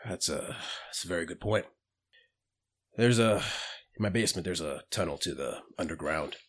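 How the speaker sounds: put-on low-key bartender recounting old business; lightly voiced bartender. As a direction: lightly play a reticent bar owner, kept soft. Plays bartender character explaining the tunnel.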